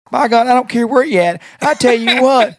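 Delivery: imitating a furious dad woken late; mock outraged Southern dad; angry dad. Quoting angry Mackey.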